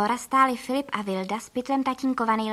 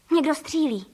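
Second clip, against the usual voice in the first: mild cry of alarm, slightly raised; exclaiming; 4 semitones higher, 4 dB louder than usual. A narrator's reading. Narrator hears a shot from the lake, exclaiming. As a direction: exclaim with a higher voice, short of a shout. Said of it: "vykřikla jsem".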